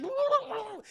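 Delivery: high startled whoa; mock robot yelp. Streamer voices R2 getting shot, high startled whoa. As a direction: squeal like a shot droid.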